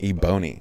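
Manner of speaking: Ebony said as "ee-BOH-nee"; mispronounced ebony. Shown as a demonstration. Guest sums up his quirky mistakes, Ebony as "ee-BOH-nee". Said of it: "the quirky dude saying Ebony".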